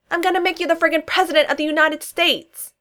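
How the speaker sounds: high, loud indignant outburst; loud exasperated yell. A narrator's reading. Josh protesting to Sam in the governor's office, indignant yell. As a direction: shout it out, arms in the air. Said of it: "Josh yelled".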